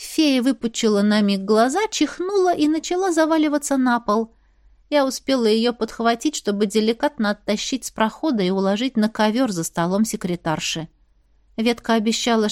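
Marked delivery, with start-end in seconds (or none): none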